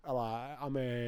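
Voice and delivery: put-on slow, drawn-out L.A. kid; lightly mock a California teen; Californian teenager voice. Co-host playing a kid from L.A., teenager voice. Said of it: "teenager voice is just a kid from California".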